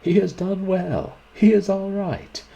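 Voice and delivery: loud, high acclaiming shout; high, ringing shout of approval; crowd's shout. A narrator's reading. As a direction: shout it out like a whole room cheering. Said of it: "the studio rose as one man, shouting".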